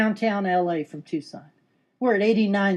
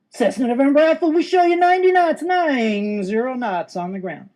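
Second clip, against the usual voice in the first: sing-song controller; 4 semitones higher, 4 dB louder than usual. Acted. Controller treating a Cessna pilot like John Glenn, sing-song. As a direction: announce it grandly, stretching the numbers. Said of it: "And they do that sing-song".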